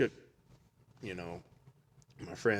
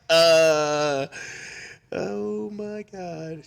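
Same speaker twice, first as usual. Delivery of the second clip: loud, high "uh", then drawn-out words; mock shock, stretched very slowly; stunned reaction; 7 semitones higher, 10 dB louder, much slower than usual. Storyteller reacts to her rubbing the wall, hammed-up shock.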